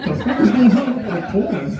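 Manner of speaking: high, loud question half swallowed by laughter; laughing disbelief. Retold or imitated. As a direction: laugh through the outrage.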